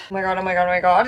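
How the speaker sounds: ordinary talk, nothing marked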